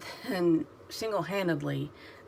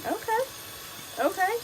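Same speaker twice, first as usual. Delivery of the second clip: much higher, expectant okay; eager okay. Retold or imitated. Waiting for the movie to start, high eager okay. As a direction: squeak it out expectantly. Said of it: "and I was like".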